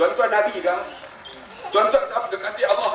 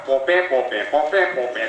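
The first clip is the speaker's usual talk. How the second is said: lower, fading nonsense drone mocking a long talker; mock droning babble; 6 semitones lower, slower than usual. Teacher mocking his principal's three-hour meeting: low babble. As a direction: mimic an endless boring speech as empty sounds. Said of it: "Dia seorang cakap".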